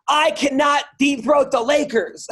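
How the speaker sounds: high, slow, pointed mock of her; indignant woman. Offended woman confronting the comedian, slow and indignant. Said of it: "She gets about six feet and goes".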